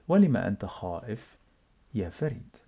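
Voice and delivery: quick and run together; fast natural speed. Shown as a demonstration. Teacher to learners, fast native-speed reading. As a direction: say it at real conversational speed. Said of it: "let's read together".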